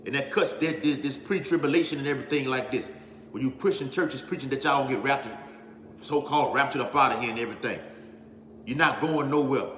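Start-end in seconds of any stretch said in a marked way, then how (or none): none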